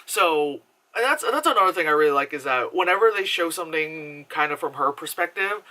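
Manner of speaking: normal talking voice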